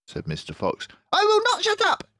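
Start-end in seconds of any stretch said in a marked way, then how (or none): 1.1-2.1 very high, loud rat shriek. Acted. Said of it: "shrieked Rat".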